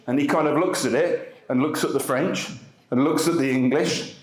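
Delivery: ordinary talk, nothing marked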